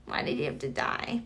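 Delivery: whispered lament to herself. Shown as a demonstration. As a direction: hush it like a secret grief. Repeats the lament, now whispered.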